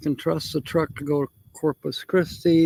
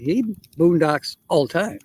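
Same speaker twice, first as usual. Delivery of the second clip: usual speaking voice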